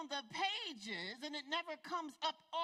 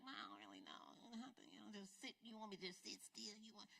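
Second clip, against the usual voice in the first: much lower, softer, fast mock voice; mock doubter; 3 semitones lower, 13 dB quieter, faster than usual. Preacher mimics a doubter of meditation, mock low. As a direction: mimic a skeptical listener. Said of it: "sounds a little like".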